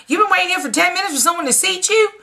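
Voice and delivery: high-pitched, quick disbelieving question; incredulous. Retold or imitated. Customer appalled at another diner's wait for seating, incredulous.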